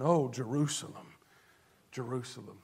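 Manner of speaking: a soft, low Oh, Jerusalem fading almost to nothing; mourning over the city in a hushed, sinking voice; grieving lament. Acted. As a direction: breathe out Oh, Jerusalem quietly, grieving. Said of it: "he grieves over the state".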